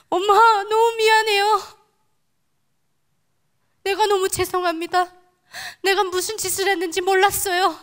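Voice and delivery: high, loud sobbing plea to mother; wailing apology. Retold or imitated. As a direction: wail out the apology while clutching her trousers. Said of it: "막 통곡을 했어요".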